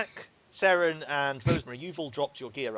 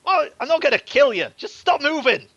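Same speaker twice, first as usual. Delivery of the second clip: much higher, loud impatient thug; Scarface voice; 13 semitones higher, 12 dB louder than usual. Acted. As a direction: snap at her to hold still. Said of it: "he goes".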